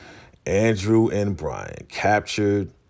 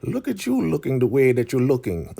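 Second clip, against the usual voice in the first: high-pitched put-on elder voice; Elder Mother Marva; 8 semitones higher, 3 dB louder than usual. Elder greets Ruth in the kitchen, high put-on voice. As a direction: tease in a high character voice. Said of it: "Elder Mother Marva's there. She's like".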